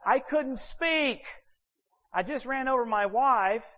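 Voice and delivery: high-pitched, drawn-out disbelief; mock freaked-out. Husband who just ran over wife, comic high panic. Reliving his freaked-out state.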